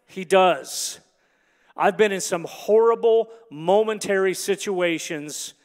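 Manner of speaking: usual speaking voice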